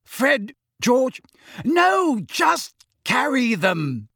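very high, alarmed shriek. A narrator's reading. Missus Weasley stopping the twins' magic, shrieking. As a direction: shriek a warning, stretching the last words. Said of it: "Missus Weasley shrieked".

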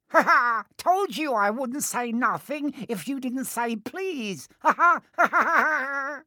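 high, gloating laughing taunt; mocking cackle. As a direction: crow over him with glee. Peeves mocking Filch.

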